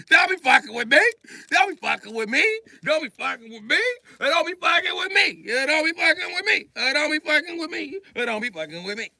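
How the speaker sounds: high, rising shouted pleas; ranting. Acted. Pleading with the devil, shouting in frustration. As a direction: rant it up then drop off.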